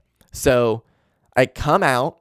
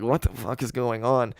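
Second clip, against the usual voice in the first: hushed, softer mock disbelief; stunned hush; 7 dB quieter than usual. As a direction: softly imitate a stunned crowd. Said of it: "the whole place is just silent".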